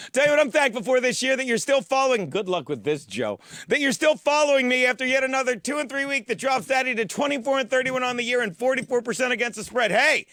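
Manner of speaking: normal talking voice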